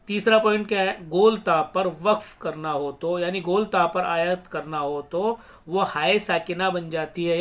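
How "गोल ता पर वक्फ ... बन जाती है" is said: ordinary talk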